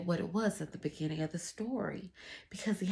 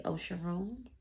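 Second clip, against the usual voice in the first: plain reading voice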